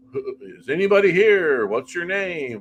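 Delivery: high, loud parody of questioning spirits; exaggerated singsong roll call for ghosts; mock ghost-hunter calling out. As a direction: call into an empty room, hammed-up.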